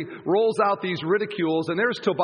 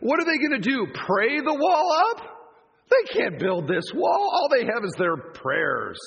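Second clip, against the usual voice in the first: high, slow sarcastic sneer; mock taunt about praying up the wall; mocking jeer; 7 semitones higher than usual. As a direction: ridicule their praying. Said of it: "He says".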